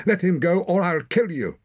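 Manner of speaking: high, loud threatening cry; shouting. A narrator's reading. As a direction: shout the threat while struggling. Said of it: "shouted Torak, struggling".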